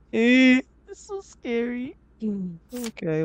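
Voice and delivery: tiny, high frightened voice; soft, high and timid; scared whimper. Real, not acted. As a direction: squeak it out softly, spooked. Scared after the rat.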